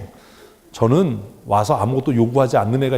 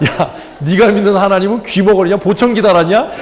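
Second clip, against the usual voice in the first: acted heckling, loud and raised; indignant retort; 7 semitones higher, 7 dB louder than usual. Irritated listener talking back to the worship leader, loud mock indignation. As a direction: fire mock-outraged questions back at him.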